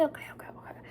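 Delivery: quick soft whisper; hushed self-soothing whisper. Real, not acted. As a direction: whisper it to calm yourself. Calming herself quietly.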